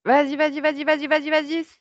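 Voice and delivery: high, loud, insistent encouragement; urgent loud urging. Real, not acted. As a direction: drive him on hard. Pousse à tout sortir. (encouragement pressant et fort)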